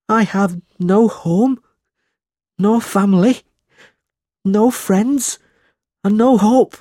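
high, slow, wailing despair; a boy crying his heart out, high and slow. A narrator's reading. Smike pouring out his misery to Nicholas, high tearful wail. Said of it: "cried Smike".

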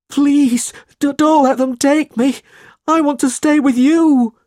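high, loud, desperate plea; pleading cry. A narrator's reading. Smike begging Nicholas to keep him, crying out in panic. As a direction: beg in a high put-on boy's voice. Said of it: "he cried".

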